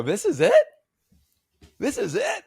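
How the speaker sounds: high, loud disbelieving repeat; incredulous. Retold or imitated. Disbelief at the bad photo.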